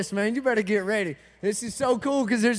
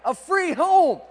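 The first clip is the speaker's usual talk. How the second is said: emphatic repeat; 4 semitones higher, 4 dB louder, much slower than usual. Shown as a demonstration. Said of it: "are you people listening to me?".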